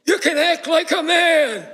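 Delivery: high, loud angry shout, slowed down; Godfather slap shout. Retold or imitated. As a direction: bark it like the furious Don slapping him. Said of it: "The Don gets up and slaps him, saying".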